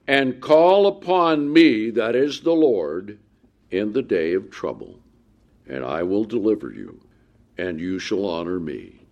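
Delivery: booming opening fading to a low hush; scripture read, loud to hushed. Acted. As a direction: proclaim then fall to a low promise. Reads verse aloud, fading down.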